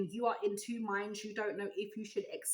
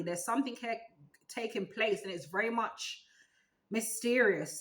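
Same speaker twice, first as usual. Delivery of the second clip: ordinary delivery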